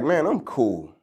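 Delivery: low, quiet, unbothered reply; laid-back Achilles. Retold or imitated. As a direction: shrug it off, low and easy. Achilles casually declines the fight.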